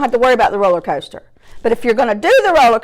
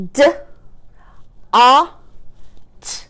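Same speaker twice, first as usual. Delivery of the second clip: "D, a, t" as three isolated sounds; chopped-out word; 3 semitones higher than usual. Shown as a demonstration. Teacher trying another word with pupils, chopped apart. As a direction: sound out DOT with long gaps. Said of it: "Let's try this one".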